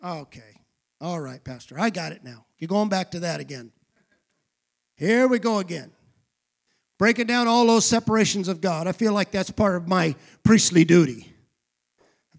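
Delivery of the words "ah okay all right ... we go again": low, soft, grudging acknowledgment; weary resignation. Acted. Congregant to pastor going back to that again, weary resignation. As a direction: play a listener who has heard it all before. Acts a weary listener answering him.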